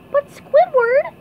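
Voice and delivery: loud, slow, high cartoon protest; SpongeBob. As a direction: protest in a squeaky pipsqueak voice. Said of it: "said SpongeBob".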